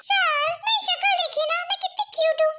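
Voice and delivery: lightly voiced high, cutesy secretary; cutesy pleading. Secretary pleads that she is cute.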